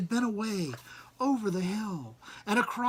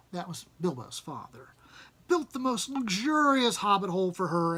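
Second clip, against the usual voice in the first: plain reading voice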